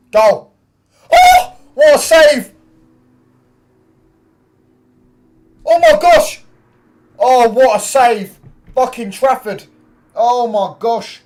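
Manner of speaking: very high, loud real shouting; astonished screaming. Real, not acted. Fan amazed by a save, astonished shouting. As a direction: yell in sheer amazement, voice leaping up. Reacting to shot and great save.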